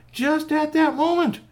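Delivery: very high, loud storytelling; piercing, urgent storyteller cry; heightened suspense. A narrator's reading. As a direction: hammer the dramatic repeat at top pitch. Dramatic repeat for suspense.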